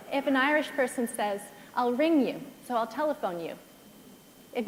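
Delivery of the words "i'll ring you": usual speaking voice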